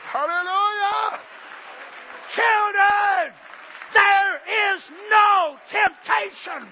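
shouted, high preaching; full-force preaching. Acted. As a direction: thunder it at the congregation.

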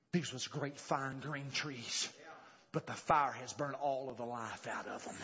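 low, softened storytelling voice; somber reflection. Real, not acted. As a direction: drop low and muse over it quietly. Somber recollection of burned trees.

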